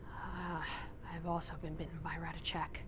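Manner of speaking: low, soft, sighing admission; sigh, then admit it quietly and low; reluctant confession. Acted. Toulouse confessing to Odette after her story, reluctant.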